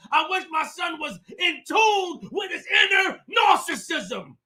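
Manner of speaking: high, loud preaching yell; heated shouting. Real, not acted. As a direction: drive it home, shouting. Heated rant, shouting at chat.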